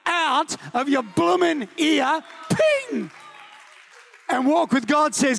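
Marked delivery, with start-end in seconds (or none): 2.5-3.1 very high, sharp ping; high ping sound. Acted. Preacher miming flicking a voice out, high ping. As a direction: flick it away with a bright ping.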